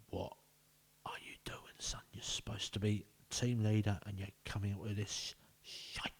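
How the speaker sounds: hissed whisper telling-off; whispered scolding. Acted. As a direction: whisper it like a furious manager in the dressing room.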